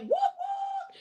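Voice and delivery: high, yelped whoop whoop; playful whoop. Real, not acted. Host hollering a welcome to a viewer in chat. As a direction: cheer a viewer in like a hype man.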